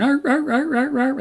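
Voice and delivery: high barking arr arr imitation of sea lions. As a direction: bark like a noisy sea lion. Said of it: "always going".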